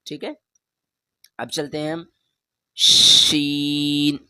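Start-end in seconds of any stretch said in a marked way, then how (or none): 2.8-4.2 شین slow and drawn out; letter name demo. Shown as a demonstration. Teacher drawing out sheen for pupils. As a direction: hold شین long for the class. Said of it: "اس پر تین dot آ گیا تو یہ شین".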